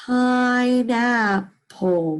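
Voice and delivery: Pineapple drawn out very slowly; teacher blending pine and apple. Shown as a demonstration. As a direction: savour each syllable of Pineapple. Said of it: "What's the word?".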